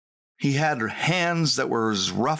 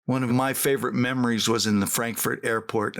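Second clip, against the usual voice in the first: normal talking voice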